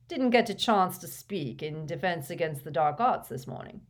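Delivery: lightly voiced pompous teen; a hint of self-important swagger; pompous schoolboy. A narrator's reading. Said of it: "his rather pompous manner".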